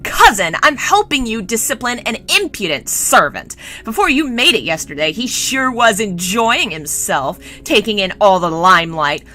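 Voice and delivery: loud, high spoiled prince in a fury; raging tirade. Acted. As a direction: rant indignantly, building to a yell. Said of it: "still rampant and raging".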